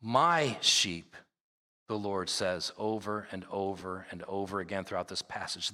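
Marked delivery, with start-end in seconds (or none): 0.0-1.2 "My" drawn out, then falling; "My" given clear emphasis; stress on MY. Shown as a demonstration. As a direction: lean hard on the word "My". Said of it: "you heard me emphasize the words".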